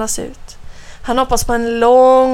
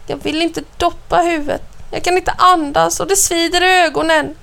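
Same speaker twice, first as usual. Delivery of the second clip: put-on higher, pleading child's protest; upset child; 7 semitones higher than usual. A narrator's reading. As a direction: plead not to go under water. Tearful boy protests in story. (ledset och bönande)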